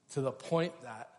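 ordinary talk

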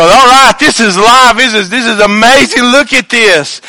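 high, loud mock delight over the restaurant; hammed-up thrill; mock excitement. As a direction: gush like a happy diner.

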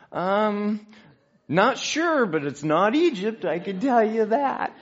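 high, loud protest, stretched out at the end; emphatic balking. Retold or imitated. Preacher replaying his answer to the doctor's call, emphatic balking.

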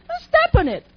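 preacher rallying the church to courage; full-force preaching. Acted. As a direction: rouse the congregation.

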